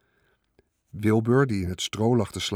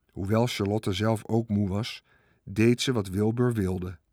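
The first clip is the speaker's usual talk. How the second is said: ordinary delivery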